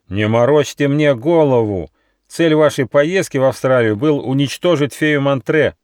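angry fox. A narrator's reading. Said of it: "стало свирепым".

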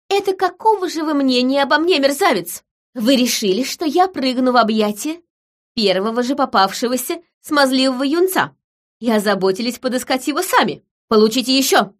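high-pitched, scolding outrage; indignant tirade. A narrator's reading. Woman slapping Gregory over his scheme, furious.

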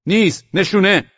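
high, loud rebuff and demand; sentry's shout. A narrator's reading. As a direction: fire the challenge out in short shouts. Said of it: "نگهبان فریاد زد". (فریاد نگهبان)

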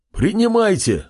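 high ringing call; shouting. A narrator's reading. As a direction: call it out to everyone. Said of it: "выкрикнул старик".

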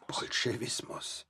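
slow, drawn-out whisper of the word; eerie whispered echo. Acted. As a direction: breathe the word out slowly like a ghostly echo. Echoing whispered word chorus.

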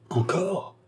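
exclamation aiguë et incrédule; un cri bref de surprise exaspérée; s'écrie. A narrator's reading. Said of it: "s'écria le marquis". (s'écrie, incrédule)

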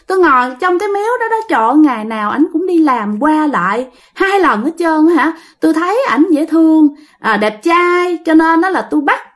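put-on high, simpering girlish voice; coy woman spirit. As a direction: coo it like a lovestruck ghost girl. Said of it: "nói giọng đàn bà luôn".

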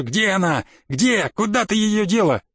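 demanding questions, pitch climbing; cried-out demand without much extra volume; agitated shouting. Acted. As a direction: frantically interrogate the secretary. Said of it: "крикнул секретарше".

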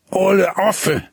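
lightly voiced boy, sulky; grumbled insult. A narrator's reading. Hinnerk answers the laughing girl sulkily. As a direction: mutter the insult back. Boy's retort.

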